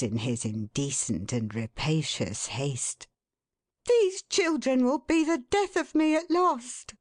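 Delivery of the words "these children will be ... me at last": much higher wife's voice, a touch louder; an exasperated mother, pitched up. A narrator's reading. Mrs Tetterby's voice.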